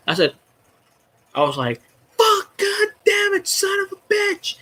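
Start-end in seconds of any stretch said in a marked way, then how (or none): 2.2-4.6 high, drawn-out strained cursing; stretched falsetto outburst, retold; mock scream. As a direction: reenact a screamed curse without full volume. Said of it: "he's out there screaming".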